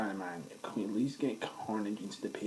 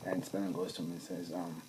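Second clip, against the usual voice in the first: ordinary delivery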